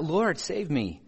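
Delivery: high, slow plea for rescue; a sinking man begging for help; desperate cry. A narrator's reading. Peter sinking in the water cries to Jesus, pleading. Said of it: "cried out".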